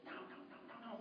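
whispered, breathless no no no; hushed no. Retold or imitated. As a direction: hush them back like a nervous parent. Parents reining kids in.

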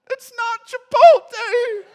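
high, loud, tearful wail through a laugh; mock-wail it like a heartbroken wife; crying wail. Husband mimics his pregnant wife crying over her burrito, loud tearful wail. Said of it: "And she cried".

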